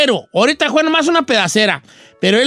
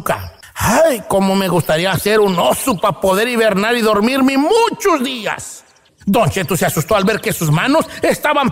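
cuento narrado; 2 semitones higher than usual. Acted. Cuento grabado con música.

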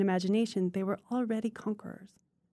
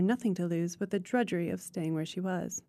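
usual speaking voice

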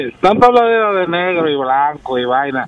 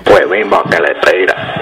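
silly slogan voice; 8 semitones lower than usual. Acted. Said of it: "¿Huevín qué?".

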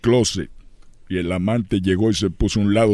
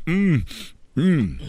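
two short mock moans, the first higher; imitated lovemaking moans; 7 semitones higher than usual. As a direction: hum out brief mock grunts of pleasure. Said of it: "se oían ruidos como".

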